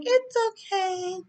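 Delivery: tiny high voice dragging the words; high, drawn-out mock forgiving. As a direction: squeak it out slowly, all sugary. Wife forgiving husband.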